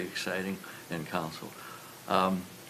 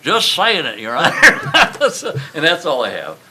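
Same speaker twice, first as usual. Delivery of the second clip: laughing; 8 semitones higher, 17 dB louder than usual. Real, not acted. Laughs while closing his remarks.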